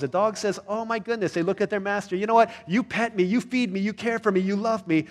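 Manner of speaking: grateful dog voice. Acted. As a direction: gush like a thankful dog to its master.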